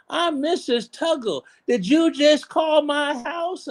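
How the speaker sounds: high, slow, suspicious woman's voice. Retold or imitated. Wife on the phone, demanding to know who called. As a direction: play the wary wife, high and drawn out.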